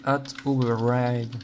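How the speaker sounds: at override said in a mock English accent; a French coder hamming up an English accent on at override. Shown as a demonstration. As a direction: pronounce at override the English way, a bit tongue-in-cheek. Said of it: "Superbe accent anglais".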